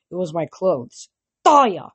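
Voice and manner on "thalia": loud, higher warning call; stern call. A narrator's reading. Chiron stopping Thalia, stern loud call. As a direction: call her sharply to stop. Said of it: "Chiron said".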